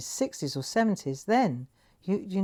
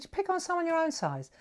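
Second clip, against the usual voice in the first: high-pitched scolding, not shouted; indignant retold challenge; 11 semitones higher than usual. Young worker confronting foreman who hit an old man, indignant challenge. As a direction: challenge the bully with pitch raised.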